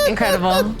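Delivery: ordinary talk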